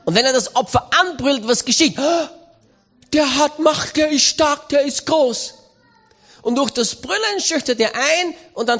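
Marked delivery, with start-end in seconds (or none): 3.1-5.5 intimidated prey. Pretend. The frightened prey's thoughts.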